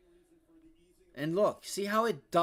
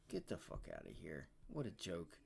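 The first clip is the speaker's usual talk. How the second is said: disgusted mutter; 10 semitones lower, 13 dB quieter than usual. Real, not acted.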